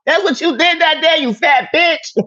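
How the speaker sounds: shrill, loud jab; high-pitched insult. Real, not acted. Taunting the harasser with a loud high jab.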